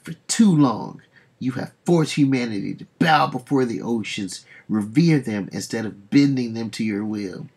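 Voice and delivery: booming, hammed-up villain speech. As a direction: declare it like a conquering king.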